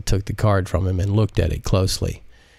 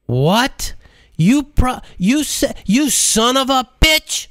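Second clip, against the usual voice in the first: snapping in fury; slower than usual. Acted. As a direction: sputter then explode at him. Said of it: "She snapped".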